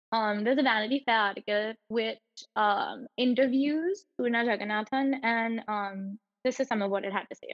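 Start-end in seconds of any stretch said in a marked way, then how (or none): none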